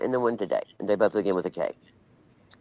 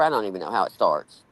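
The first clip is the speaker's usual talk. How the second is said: ordinary talk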